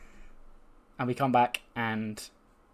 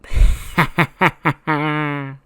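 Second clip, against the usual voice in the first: loud, higher evil cackle, held at the end; villainous laugh; 4 semitones higher, 9 dB louder than usual. Acted. Wrestler leaving the crowd guessing, villainous cackle. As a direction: burst into a gloating staccato cackle.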